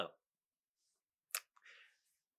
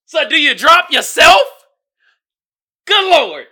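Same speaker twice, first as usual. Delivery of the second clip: loud, high incredulous shout; exasperated comic yell; mock outrage; 9 semitones higher, 10 dB louder than usual. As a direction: fire it off in comic disbelief.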